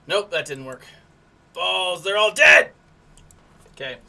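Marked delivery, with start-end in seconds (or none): 1.5-2.7 louder frustrated outburst; loud exclamation. Real, not acted. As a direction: blurt it out, exasperated. Whole team dies in game.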